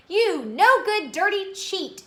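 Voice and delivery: high, loud, drawn-out accusation; angry shout. A narrator's reading. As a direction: shout it slowly, hitting each insult. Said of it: "he shouted".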